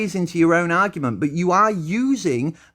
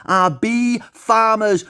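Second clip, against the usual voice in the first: ordinary talk, nothing marked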